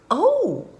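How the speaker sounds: high, loud "oh" of delight; a bright burst of storybook excitement; excited interjection. Acted. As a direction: pipe up in sudden delight. Spidey reacts to fairy tale opening.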